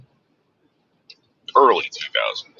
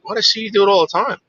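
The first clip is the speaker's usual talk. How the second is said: much higher and fast, protesting; retold protest to the cop; indignant disbelief; 13 semitones higher, faster than usual. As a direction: fire the question back in disbelief. Arguing with the cop.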